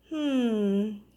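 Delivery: ordinary delivery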